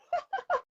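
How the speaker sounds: a real high burst of laughter. Real, not acted. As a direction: laugh at the memory. Laughs after child impression.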